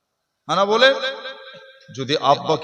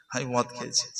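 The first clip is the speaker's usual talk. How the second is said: low, soft sheepish admission; timid confession; 5 semitones lower, 8 dB quieter, faster than usual. Retold or imitated. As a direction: mumble it like a caught sinner. Drunkard confesses to the cleric.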